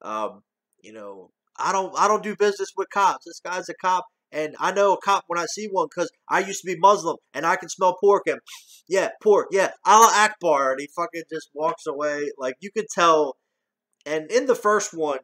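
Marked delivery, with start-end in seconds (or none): 1.6-10.7 higher, louder, building to a near-shout; imitating Axel's routine. Reviewer reenacting the cop scene, growing louder. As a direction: lightly play the fast-talking hustler.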